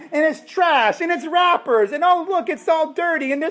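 very high, squealing mock delight; high, gushing voice of a mock-delighted buyer; mock surprise. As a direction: gush in mock amazement at the trash. Mock shopper in dirty used car.

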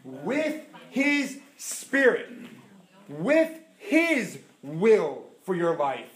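very slow, each phrase drawn out and weighted; slow emphatic preaching. Acted. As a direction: hammer home each answer slowly. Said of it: "preaching emphasis".

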